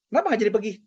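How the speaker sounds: high, loud retold exchange; mother and son exchange. Son telling mom at the table he wants to ask, high and loud. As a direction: act out the back and forth brightly. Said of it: "Saya bilang".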